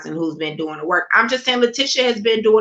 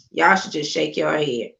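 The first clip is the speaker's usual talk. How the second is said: usual speaking voice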